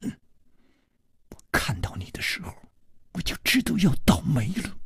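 quick, softly spoken grumble; mock fierce. A narrator's reading. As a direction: grumble at him fast and under the breath. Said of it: "狠狠地说". (假装狠狠地小声抱怨)

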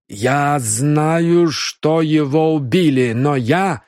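put-on fearful slow Indian-accented denial. Indian suspect in broken Russian.

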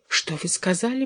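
small, meek voice, taken aback; timid babble. A narrator's reading. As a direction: babble it meekly, barely daring to ask. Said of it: "пролепетал он".

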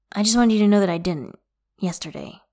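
ordinary delivery

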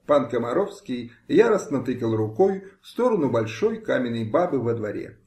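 ordinary delivery

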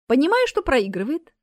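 ordinary delivery, nothing marked

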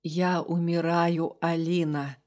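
low, slow fading voice; weak dying man. Acted. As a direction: barely hold on to the words. Dying demon tells his fate. (слабый голос умирающего)

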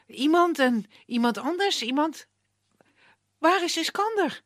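bewildered, rising high with confusion; confused girl. A narrator's reading. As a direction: she can't make sense of it.

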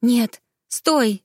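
put-on a girl pleading with the boy; urgent plea. A narrator's reading. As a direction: stop him before he calls. She begs him to stop.

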